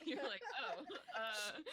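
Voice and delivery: words broken by laughter; laughing. Real, not acted. GM laughs while teasing the flustered player.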